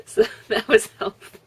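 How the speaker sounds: ordinary delivery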